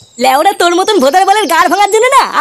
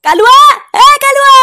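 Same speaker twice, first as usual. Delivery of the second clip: loud, higher-pitched holler; angry calling out; 5 semitones higher, 5 dB louder than usual. Acted. Father summons his son's attacker, angry holler. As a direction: shout for him to come out. Calls enemy out of his house.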